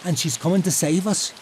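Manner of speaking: put-on high, stubborn boy insisting; lightly voiced obstinate boy. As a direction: dig in like a defiant kid. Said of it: "Liam said obstinately".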